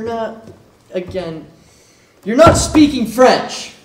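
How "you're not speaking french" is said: loud slow frustrated outburst; exasperated shout. Acted. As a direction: burst out in exasperation. Teacher finally explodes.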